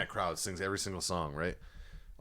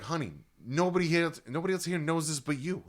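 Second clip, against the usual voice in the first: wife calming him; 8 semitones higher, 4 dB louder than usual. Retold or imitated. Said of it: "his wife was like".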